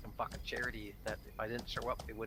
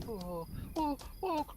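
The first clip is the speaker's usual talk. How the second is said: fussing host; 13 semitones higher than usual. Retold or imitated. Host fussing over a guest as if starving, high and frantic. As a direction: pile food on the guest.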